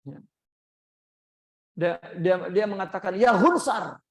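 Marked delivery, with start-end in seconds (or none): 3.1-4.0 sharp, louder scolding of a son; angry insult. Retold or imitated. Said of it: "tak biasalah diucapkan oleh Sayyidina Abu Bakar".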